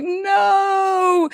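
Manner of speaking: high wailing no; anguished cry. Retold or imitated. Retelling seeing the dead whale, wailing no. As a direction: cry out in disbelief. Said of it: "And I was like".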